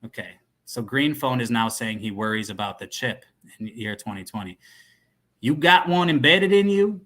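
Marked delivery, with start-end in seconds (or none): 5.4-7.0 high, loud questioning voice; put-on dealer voice. Dealer asking about the chip, high loud put-on voice. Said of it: "he'll be like the criminal voice".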